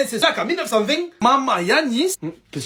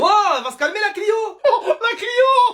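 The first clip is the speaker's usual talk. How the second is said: jeering taunt; 8 semitones higher than usual. Acted. Pupil teasing insulted classmate, jeering taunt. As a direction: climb higher and stretch the final jeer.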